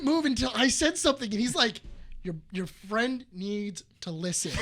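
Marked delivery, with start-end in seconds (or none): 2.2-4.5 slow, lower, measured complaint; restrained frustration. Retold or imitated. Buddy complaining to Brian's friend, slow and restrained. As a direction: space it out, holding back.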